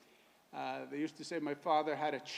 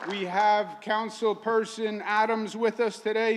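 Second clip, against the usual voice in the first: raised, projected announcer voice; rally introductions; 5 semitones higher, 6 dB louder than usual. Real, not acted. Host introducing community guests to the crowd, rally announcer. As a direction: announce each guest to the crowd, building to a loud finish.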